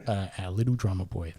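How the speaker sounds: usual speaking voice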